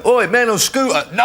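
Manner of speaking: mock yell. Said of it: "I could just see you going".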